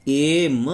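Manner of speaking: "एम" split into a long "e" and then "ma"; sounded-out letter. Shown as a demonstration. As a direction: stretch the opening vowel of "एम", then land on m. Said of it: "एम का उच्चारण जो होता है".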